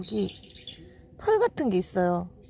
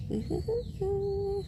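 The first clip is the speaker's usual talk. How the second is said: giggle; 10 semitones higher, 6 dB quieter, much slower than usual. Real, not acted. Giggle at the dog.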